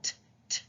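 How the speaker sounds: short unvoiced taps, t t t; tapping T sound. Shown as a demonstration. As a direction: tap the tongue, t. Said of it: "like you're forcing air out".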